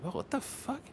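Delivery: high-pitched bewildered protest; baffled reaction. Retold or imitated. Reacting to hostile Instagram replies, baffled. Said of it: "I'm like".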